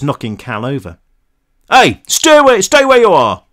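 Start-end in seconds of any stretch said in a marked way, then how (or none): 1.7-3.4 high, loud guard's yell; full shout, pitched way up. A narrator's reading. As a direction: yell a warning from a distance. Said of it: "the guard yelled".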